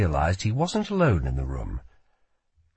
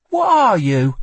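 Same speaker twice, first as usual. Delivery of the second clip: put-on higher, sharp girl voice; young woman voice; 9 semitones higher, 7 dB louder than usual. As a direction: voice a wary young thief. Lila questions her captive.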